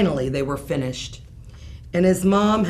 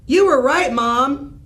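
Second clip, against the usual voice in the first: calling out; 7 semitones higher, 5 dB louder than usual. Acted. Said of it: "Spencer called".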